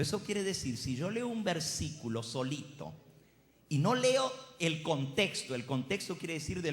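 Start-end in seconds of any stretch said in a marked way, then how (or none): none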